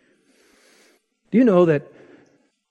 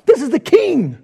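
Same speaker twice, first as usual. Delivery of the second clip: much higher, louder exclamation; emphatic outcry; 11 semitones higher, 6 dB louder than usual. Real, not acted. Preacher to congregation, crying out that this is the king. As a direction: cry out the outrage. Shimei cursing King David.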